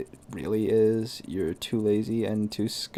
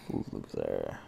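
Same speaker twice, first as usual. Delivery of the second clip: low mocking voice; 8 dB quieter than usual. Voicing game character taunt.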